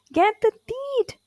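very high shouted call; Kipper shouting. A narrator's reading. Kipper shouting to the crowd chasing the dog, high. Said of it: "shouted Kipper".

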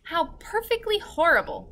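put-on high, horrified little girl. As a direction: say it in disgust. Sophie's voice, disgusted.

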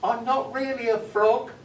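high, loud squeaky frog bragging; a tiny frog puffing itself up; boastful frog. Acted. Frog boasting to the man who picked it up, high squeak. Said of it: "and the frog said".

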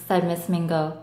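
usual speaking voice

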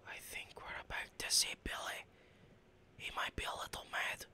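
hushed whisper, sneaking closer. Real, not acted. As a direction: whisper so Billy won't hear. Sneaking up on Billy.

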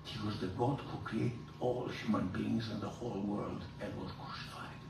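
ordinary delivery

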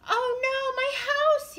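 very high, loud cry; yelling in dismay. A narrator's reading. Beaver watching his house collapse, yelling high. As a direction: yell it out high, full of alarm. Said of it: "yelled Beaver".

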